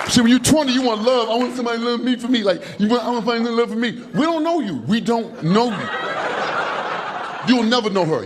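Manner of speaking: ordinary delivery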